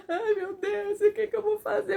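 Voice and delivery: high, tearful wail breaking into a laugh; crying in despair. Retold or imitated. Alone after the news, crying in despair. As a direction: sob it out, lost and helpless. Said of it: "olha, eu chorava".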